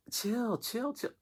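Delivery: a high soft mock chill; telling kids to chill. Calming the kids.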